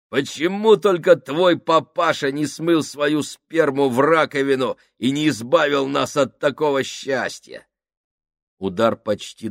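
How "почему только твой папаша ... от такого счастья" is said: громче и выше, с глумлением; бей оскорблением в полный голос; громкая издевка. Acted. Сакс оскорбляет Фабрини. (Сакс оскорбляет Фабрини, громко и зло)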